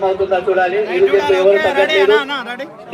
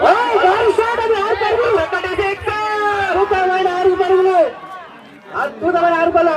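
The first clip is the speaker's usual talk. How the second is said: excited commentary; 9 semitones higher than usual. Real, not acted. Commentator calls a six.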